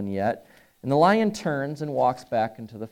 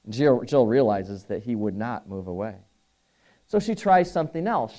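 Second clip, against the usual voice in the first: ordinary talk, nothing marked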